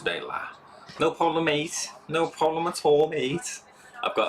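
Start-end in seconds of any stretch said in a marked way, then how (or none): 1.0-1.9 put-on high-pitched Scouse accent; Scouse impression. Replying to a viewer's message, high Scouse impression. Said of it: "I model my Scouse impression off of him". 2.1-3.6 put-on high-pitched Scouse accent; Scouse impression. Thanking a viewer back, high Scouse impression. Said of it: "I model my Scouse impression off of him".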